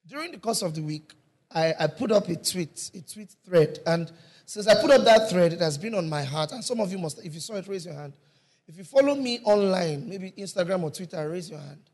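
loud, full-force preaching. Acted. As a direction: preach it out to the whole hall.

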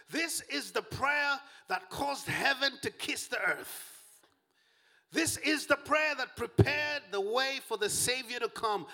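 high, ringing preacher's cry; impassioned preaching. Real, not acted. Preacher proclaims Mary's prayer to the congregation, impassioned preaching. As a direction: lift the pitch and proclaim it with full fervor.

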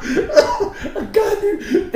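high squealing laugh through the words; high-pitched laughing disbelief. Real, not acted. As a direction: crack up at the absurdity.

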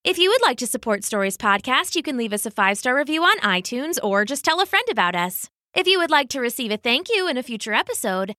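usual speaking voice

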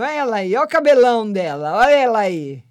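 normal talking voice

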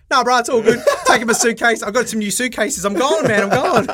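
high, loud, laughing imitation of an eager traveller; mock carefree excitement. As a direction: play the mate who just books and goes.

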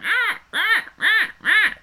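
high squawking crow imitation; crow caws. Retelling the movie's crow cawing, high squawks. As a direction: caw like a crow in a trailer. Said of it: "there was a crow going".

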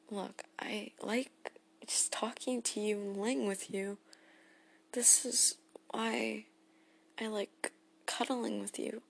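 soft whisper. Acted. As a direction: hush it like pillow talk while cuddling. Intimate cuddling talk.

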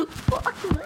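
high shrieking laugh; can't stop laughing; laughing hysterically. Real, not acted.

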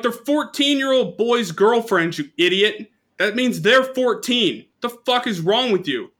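high, loud, slow incredulous scolding; outraged retort. Retold or imitated. Teen scolding the drunk umpire, high loud outrage. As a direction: scold him in stunned outrage.

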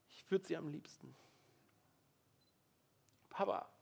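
broken prayer. Pretend. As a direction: falter and break off, almost nothing left. Example prayer, breaking down.

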